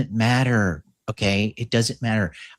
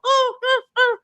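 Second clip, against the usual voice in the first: very high yelping dog whimpers; mock whimpering dog. Playing the bitten-at dog, high whimpers. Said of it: "The dog's like".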